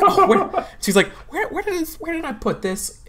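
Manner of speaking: lost the folder, flapping; flustered. Acted. Counselor searching her desk, high and flustered.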